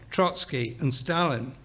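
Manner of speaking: ordinary delivery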